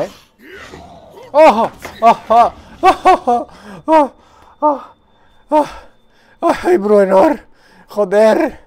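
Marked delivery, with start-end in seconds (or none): 1.3-8.6 loud high warning shouts; alarmed shout. Real, not acted. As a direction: yell a warning. Warning shouts during fight.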